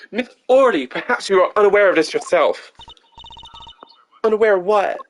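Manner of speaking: lightly voiced courtroom clash; lawyer and witness. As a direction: confront her lightly.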